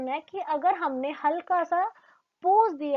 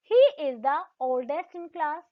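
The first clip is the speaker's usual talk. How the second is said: slow, louder example sentence; slow example read; 3 dB louder, much slower than usual. Shown as a demonstration. Teacher reads the example sentence to students slowly.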